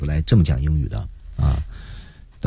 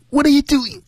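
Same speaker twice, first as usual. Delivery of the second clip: very high squeezed squeak; worried and fearful. Shown as a demonstration. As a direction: let fear squeeze the throat tight. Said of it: "要把那种担心和恐惧要表达出来".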